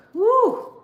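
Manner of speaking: sharp, high, loud whew of feeling; emotional whew. Real, not acted. Nearly crying about lost years, a loud high whew. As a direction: blow out an overwhelmed whew. Glad she finally woke up.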